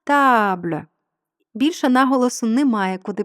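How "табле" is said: "табле" with long stressed ТА, silent final е; single stressed vowel. Shown as a demonstration. Teacher shows the only vowel left, stress on ТА in "табле". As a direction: stretch the А of "табле" and swallow the ending. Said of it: "у нас залишається єдина голосна".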